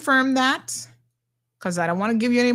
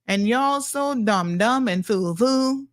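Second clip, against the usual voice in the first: usual speaking voice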